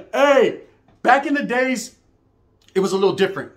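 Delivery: ordinary delivery, nothing marked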